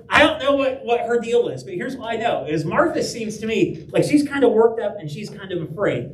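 ordinary talk

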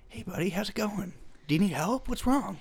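high, airy imitation of a friendly guy; Mark Wahlberg impression. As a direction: mimic a soft, eager Mark Wahlberg. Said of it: "His whole thing is just".

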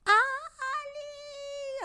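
very high, held, soft mock yell; distant friend calling, kept small; imitated distant call. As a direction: stretch the name like a far-away cry. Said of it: "a lo lejos".

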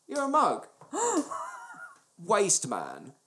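ordinary talk

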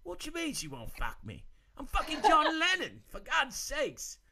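indignant, high-pitched bad British impression; hammed-up outrage, dragging out the last words; put-on British accent, mock furious. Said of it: "[name] right now is fucking pissed".

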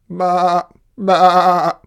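loud, high bleating baa; sheep's bleat. Acted. Sheep calling to the spider, loud bleat. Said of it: "bleated the sheep".

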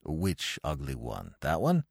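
low, soft doting father; low fond father humouring his son; father's voice. Acted. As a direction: coo it down low to the child. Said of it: "said old Blumenfield".

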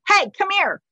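high, loud summons; imitated yelling. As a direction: call out sharply, imitating her yell. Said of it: "yelling at you a little bit".

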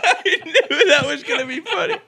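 high, breathless laughing speech; cracking up. Real, not acted. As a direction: say it through helpless real laughter. Cracks up at the girl voice.

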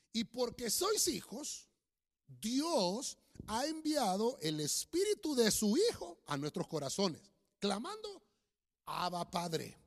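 loud, slow, declaimed Bible verse; solemn, weighty scripture reading; preacher reading scripture. Real, not acted. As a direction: proclaim it phrase by phrase with long pauses. Reads scripture aloud to congregation.

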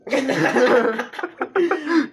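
big high burst of laughter; real loud laugh. Real, not acted. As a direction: crack up loudly. Laughing at a joke about the mother.

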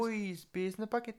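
high-pitched mock Cork lilt; Cork accent. Co-host trading Cork impressions, high mock lilt. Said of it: "I was gonna do Cork again".

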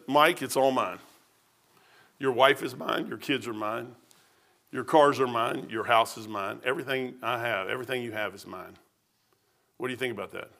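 low, hushed, matter-of-fact claim; quiet voice of God. Retold or imitated. God telling Mike it's all His, low and quiet. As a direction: drop low and soft, state ownership calmly.

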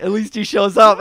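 shouted comeback; loud roast shout. Retold or imitated. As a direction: yell the burn out. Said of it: "Dan was like".

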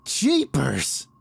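small high-pitched exclamation; high, almost childlike. A narrator's reading. Gardner faces the gaping bag, high and almost childlike. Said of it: "high and almost childlike".

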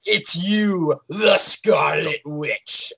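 lightly voiced ominous Agatha, drawn out slow; a hint of the villain unmasking her, slowly; imitating Agatha. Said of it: "just being like".

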